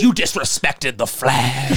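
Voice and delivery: slow, drawn-out mock outrage; imitated yelling. Godfather dressing John down outside the cinema, mock outrage. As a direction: stretch it out as a put-on scolding. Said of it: "he, like, yelling at John".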